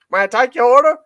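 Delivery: high, polite drive-through voice; a lightly voiced cashier; drive-through worker. Comedian voicing the drive-through cashier, high and polite.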